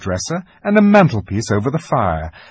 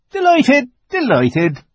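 high, loud burst of delight; delighted fawn; 13 semitones higher, 6 dB louder, slower than usual. A narrator's reading. Fawn recovers himself before Lucy, high effusive delight. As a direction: gush it, starting very high.

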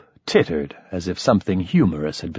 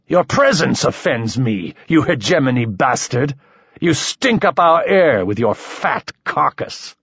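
angry insult; 6 semitones higher than usual. Acted. Noble insults Mike in rage.

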